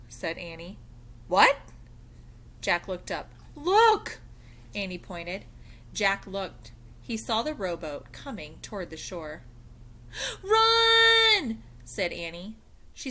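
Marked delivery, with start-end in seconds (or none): 1.3-1.6 startled. Acted. Jack startled. 3.6-4.2 urgent cry. Acted. Annie points out rowboat. 10.4-11.6 urgent shout. Acted. Annie flees pirates.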